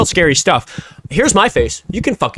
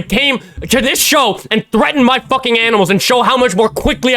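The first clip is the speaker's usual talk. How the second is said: furious yelling rant; 6 semitones higher than usual. Real, not acted. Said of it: "I threw my voice out yelling at that bitch".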